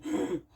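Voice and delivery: short soft mock gasp of shock; quiet imitated intake of breath. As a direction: gasp like a startled crowd. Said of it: "everyone's going".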